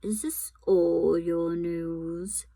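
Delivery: slow, whispered menace; cruel. A narrator's reading. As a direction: purr it with a cruel smile. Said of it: "slow, cruel smile".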